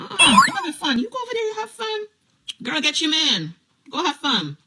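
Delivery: high, mocking brush-off, words rushed; sassy dismissal. Brushing off the boyfriend, sending him to his girl with sass.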